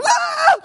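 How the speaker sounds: a high startled shriek broken by laughter; laughing mock scream. Preacher recalling childhood pranks, laughing mock shriek. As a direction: squeal like a scared kid mid-prank. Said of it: "and they go".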